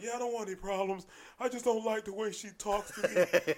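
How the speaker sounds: hammed-up weepy pleading; mock tearful complaint. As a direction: imitate a big man bawling as he complains. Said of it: "this guy's balling".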